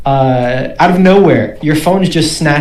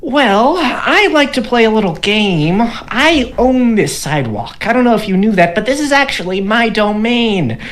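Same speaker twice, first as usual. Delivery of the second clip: gnome voice; 7 semitones higher than usual. Acted. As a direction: taunt like a smug little gnome. Gnome voice continues.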